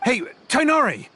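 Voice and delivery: high call from a distance. Acted. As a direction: shout his name to reach him across the valley. Calling to him from afar.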